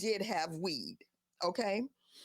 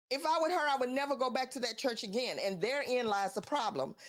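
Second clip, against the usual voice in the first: ordinary delivery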